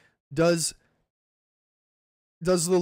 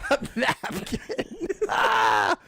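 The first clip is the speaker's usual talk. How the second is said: hammed-up panic; 12 semitones higher, much slower than usual. Mock dramatic reaction.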